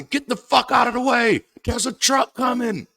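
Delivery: high, loud yell to get out of the way; yelling at someone in the road to move; shouted warning. Pretend. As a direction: shout it like a truck is bearing down. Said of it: "I say".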